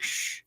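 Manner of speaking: a soft imitated shush. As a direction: hush someone like a kid keeping a secret. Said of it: "She shushed me".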